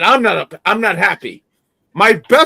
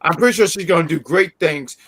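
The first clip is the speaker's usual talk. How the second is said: normal talking voice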